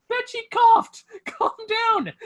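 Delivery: yelling at a man for coughing, high mock outburst; shrill mock yell. A guy shouting at a cough, high shrieking mock yell.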